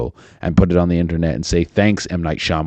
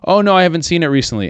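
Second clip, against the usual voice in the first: high, quick mock-chatty answer; acted casual reply; 9 semitones higher, much faster than usual. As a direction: toss it off fast and pitched up. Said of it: "I wanted to be like".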